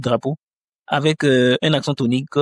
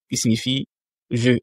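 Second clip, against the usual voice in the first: ordinary talk, nothing marked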